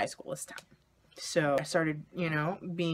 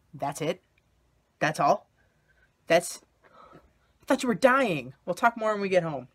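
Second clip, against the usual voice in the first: relieved disbelief; 4 semitones higher, 10 dB louder than usual. Retold or imitated. Dad relieved at coming out.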